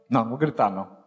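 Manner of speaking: low, soft, deadpan no; calm refusal. Retold or imitated. His calm refusal to yell.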